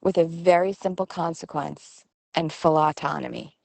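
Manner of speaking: usual speaking voice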